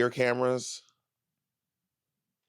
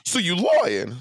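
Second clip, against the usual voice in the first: loud accusation; 6 semitones higher, 4 dB louder than usual. Real, not acted. Playful accusation, louder repeat.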